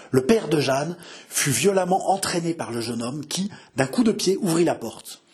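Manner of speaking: ordinary delivery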